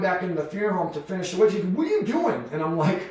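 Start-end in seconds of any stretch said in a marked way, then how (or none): 1.7-2.4 high-pitched mock disbelief; incredulous. Coworker seeing him return in camo, high imitated disbelief. Partner sees him in camouflage clothes.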